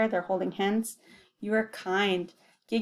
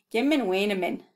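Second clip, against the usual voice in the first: ordinary delivery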